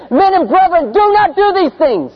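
loud, high pleading cry. Acted. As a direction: cry out to stop the crowd. Quoting apostle crying out.

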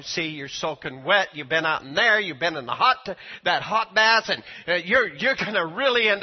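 loud, scolding nag at the door; scolding wife. Retold or imitated. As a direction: scold him like a worried wife.

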